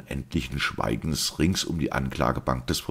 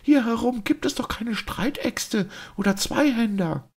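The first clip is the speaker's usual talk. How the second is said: a duchess losing her composure, crying out in alarm; shrill hysterical outburst, much higher; near hysterical. A narrator's reading. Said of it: "beinahe in einem hysterischen Anfalle".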